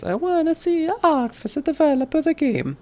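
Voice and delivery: high, lightly mocking chat voice; mock quote of a chatter. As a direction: read the viewer's comment in a silly high voice. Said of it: "Joseph says".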